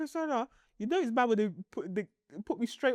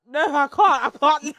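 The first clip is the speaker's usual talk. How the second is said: pained protest; 3 semitones higher, 9 dB louder than usual. Retold or imitated. Retold protest at lying down.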